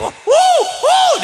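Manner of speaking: loud, high hooting howl; mock high howl. (lolongan tinggi bercanda)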